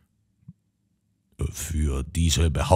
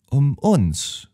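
unsure; 8 semitones higher than usual. A narrator's reading. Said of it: "fragte Josephine unsicher".

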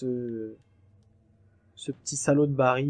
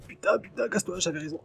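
much higher, frantic cursing; panicked shriek; 6 semitones higher than usual. Real, not acted. Player fleeing the spider, shrieking in panic. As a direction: squeal it as the spider lunges.

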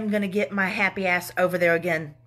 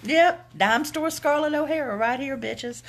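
Southern belle, pitched up and brash; dime store Southern Belle; 7 semitones higher, 3 dB louder than usual. Real, not acted. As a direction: announce yourself proudly in a Southern accent. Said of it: "I sound like a dime store Southern Belle".

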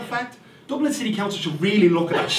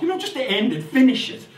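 ordinary delivery

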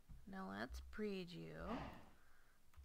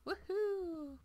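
cheer; 9 semitones higher, 4 dB louder than usual. Real, not acted. Seeing the arches.

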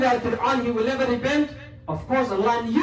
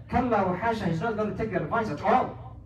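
usual speaking voice

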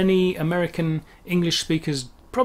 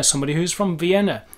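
normal talking voice

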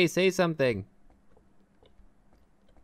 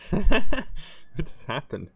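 laughing; 8 semitones higher, 5 dB louder, much slower than usual. Real, not acted. Laughing at the vote.

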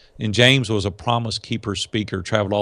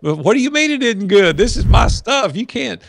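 high, louder indignant protest; defensive outrage at criticism, pitched up; mock indignation; 10 semitones higher, 4 dB louder than usual. Owner defending his idea, mock indignant.

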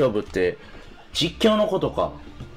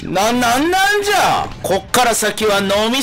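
shocked shouting; 9 semitones higher than usual. Real, not acted. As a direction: yell in disbelief at the screen. Baffled shouting at game. (驚いて叫ぶ)

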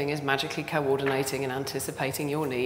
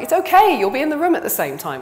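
high, loud mocking singsong; mock it as cheerfully absurd; sarcastic mock reassurance; 12 semitones higher, 11 dB louder than usual. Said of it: "It's like".

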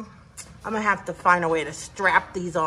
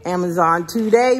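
ordinary delivery

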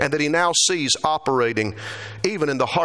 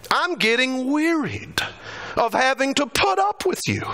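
weary exasperation; 9 semitones higher than usual. Acted. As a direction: let the tiredness and irritation rise through the line. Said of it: "When Jesus says".

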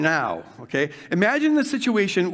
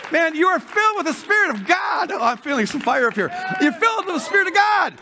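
revival-pitch shouting; fiery preaching; 8 semitones higher than usual. Real, not acted. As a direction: preach it with the fire on you. Preaching fire, "feeling some fire.

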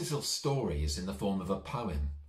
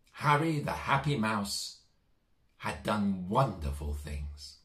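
put-on lilting, sing-song rhyme for kids; gentle children's storyteller voice; storybook poem reading. A narrator's reading. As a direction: read it like a bedtime poem to little ones.

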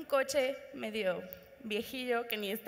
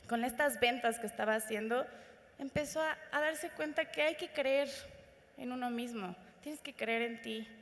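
ordinary talk, nothing marked

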